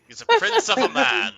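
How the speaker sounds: high, loud mock praise; mock grand. Joking line, much higher, louder.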